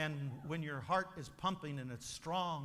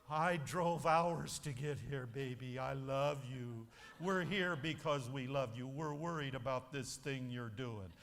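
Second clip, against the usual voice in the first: gentle fatherly concern; 7 semitones lower than usual. Acted. Parent voice at intervention.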